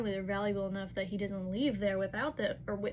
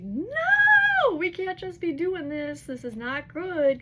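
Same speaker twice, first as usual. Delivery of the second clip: a shrill, much higher, louder no that stays loud; horrified cry; 8 semitones higher, 10 dB louder, slower than usual. Retold or imitated. Cormac objects to the group blowing up the ship, horrified shout. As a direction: cry out in protest, then keep pressing.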